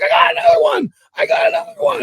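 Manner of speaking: very high, loud mock yell; frantic excitement. Partner hollers about a second fish, frantically excited. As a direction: imitate an angler shrieking over a bite.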